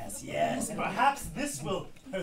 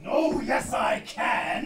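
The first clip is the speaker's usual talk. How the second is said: loud gloating villain; panto shout; 2 semitones higher, 6 dB louder, much slower than usual. Acted. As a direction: bellow it back at them. Panto oh yes I can.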